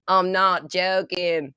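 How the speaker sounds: put-on slow, drawn-out Antipodean accent; imitated lottery winner's accent. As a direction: mimic the winner's accent, stretching the words. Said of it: "I love that accent".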